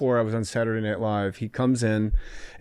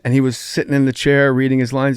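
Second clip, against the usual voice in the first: ordinary talk, nothing marked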